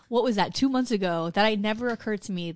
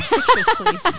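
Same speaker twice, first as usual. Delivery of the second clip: much higher pleading please; 13 semitones higher, 8 dB louder, much slower than usual. Real, not acted. Asking friend for pictures of Italian men, pleading. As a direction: beg playfully.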